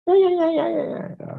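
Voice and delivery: very high-pitched mock excited preacher; shrill. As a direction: imitate a voice turning shrill with excitement.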